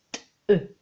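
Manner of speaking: "t-, e" split into t and e; "t-, e" letter by letter; sounded out. Shown as a demonstration. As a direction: separate the sounds "t-, e". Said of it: "la dernière syllabe".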